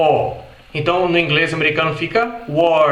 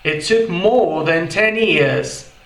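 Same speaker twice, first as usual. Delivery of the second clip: slow British "more" with no R; British English, no R in "more"; British accent; much slower than usual. Shown as a demonstration. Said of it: "isso no inglês britânico". (inglês britânico lento, sem R)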